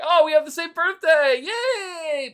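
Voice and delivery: mock-excited, very high gushing; excited squeal. Player replays a moment of birthday excitement, excited squeal. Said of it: "I got really excited for a second".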